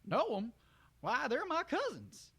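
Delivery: high, slow, drawling country voice; ramble, puzzled and friendly; folksy country stallion. Acted. Brayburn introducing himself to the mare, high country drawl.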